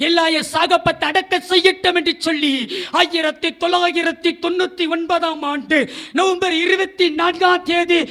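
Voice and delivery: ordinary delivery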